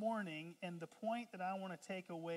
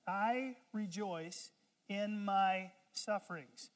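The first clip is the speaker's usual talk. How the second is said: slow reading; 3 dB louder, slower than usual. Shown as a demonstration. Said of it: "read verse twenty-four".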